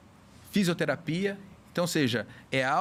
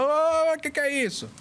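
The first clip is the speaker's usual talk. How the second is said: mock alarmed exclamation, pitched way up; vizinho espantado; 12 semitones higher than usual. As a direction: imitate a startled neighbour calling out, high and drawn-out. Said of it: "O vizinho fala". (vizinho espantado, imitado em tom agudo)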